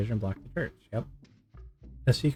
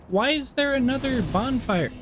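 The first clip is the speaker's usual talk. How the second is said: much higher, incredulous question; baffled; 12 semitones higher than usual. Real, not acted. Player baffled at finding another bonfire, incredulous. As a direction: sound truly baffled by the bonfire.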